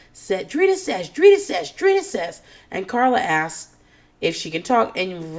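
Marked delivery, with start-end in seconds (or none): 0.5-2.4 high, louder repeated yelling; mock screaming. Ramona screaming at Drita, mock shouting. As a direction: yell it over and over, hammed up. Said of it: "Ramona's screaming at Drita".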